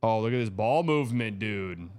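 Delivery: ordinary delivery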